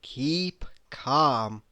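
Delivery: ordinary talk, nothing marked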